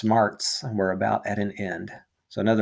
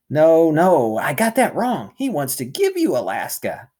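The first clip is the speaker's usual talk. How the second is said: hasty correction; 6 semitones higher, 4 dB louder than usual. Acted. Rictus hastily corrects himself.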